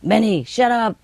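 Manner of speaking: parrot's scolding of a noisy dog; imitated telling-off. Parrot tells the dogs off, mock scolding. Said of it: "she tell the dogs off".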